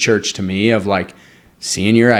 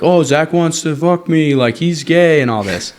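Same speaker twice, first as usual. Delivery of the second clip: mocking bully; 7 semitones higher than usual. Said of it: "And he's like".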